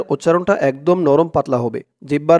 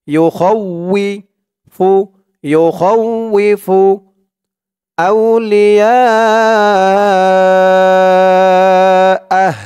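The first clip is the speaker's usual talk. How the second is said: very slow chant, last word held; recitation; 3 semitones higher, 5 dB louder, much slower than usual. Shown as a demonstration. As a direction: stretch the final word long. Melodic recitation.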